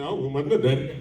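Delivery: put-on soft, timid kid voice; sheepish kid. Caught son answering his father, timid and soft. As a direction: mumble it caught out. Son's sheepish reply, quiet.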